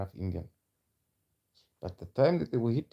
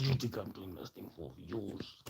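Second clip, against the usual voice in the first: much softer, menacing comeback; 2 semitones lower, 11 dB quieter than usual. Real, not acted. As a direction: drop the volume and throw it back. Throws the accusation back at critics.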